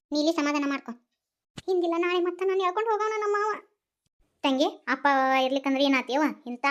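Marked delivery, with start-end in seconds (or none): none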